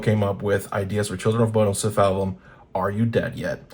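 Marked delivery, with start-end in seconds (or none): none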